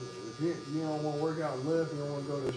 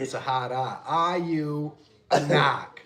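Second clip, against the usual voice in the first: mock Boston accent; 2 semitones higher, 7 dB louder, slower than usual. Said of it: "that was comedy".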